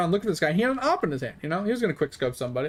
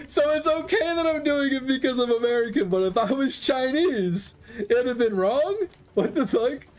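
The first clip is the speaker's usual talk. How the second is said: much higher, half-shouted disbelief; mock indignant shout; 8 semitones higher than usual. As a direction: rant like you can't believe the hypocrisy. Indignant at the double standard.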